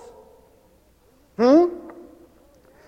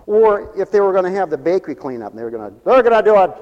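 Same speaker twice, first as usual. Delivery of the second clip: ordinary delivery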